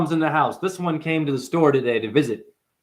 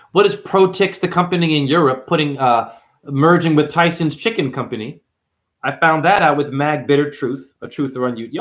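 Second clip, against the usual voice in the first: ordinary delivery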